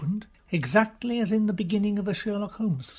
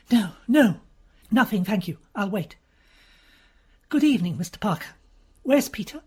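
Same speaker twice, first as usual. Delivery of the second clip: put-on higher, urgent young lady; anxious noblewoman, hurried; breathless society lady in a rush; agitated Lady Mary; 4 semitones higher, 6 dB louder than usual.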